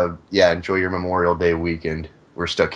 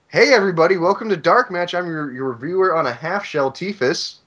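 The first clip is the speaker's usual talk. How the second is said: high-pitched host welcome; show intro; 8 semitones higher than usual. Real, not acted. Host welcoming listeners to Dark Match, showy intro. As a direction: open big and high, easing off toward the name.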